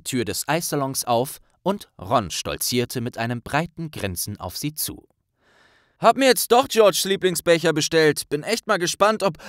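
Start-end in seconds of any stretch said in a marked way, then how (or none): 6.0-9.4 louder, higher cheerful Ron; a cocky, grinning Ron. Acted. Ron-Figur spricht. (laute, fröhliche Ron-Stimme)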